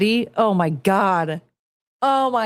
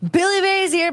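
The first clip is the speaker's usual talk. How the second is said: mock Billy Mays; 10 semitones higher than usual. Mocking Billy Mays' ad opener, raised and high. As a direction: parody the ad opener. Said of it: "mocking the ad".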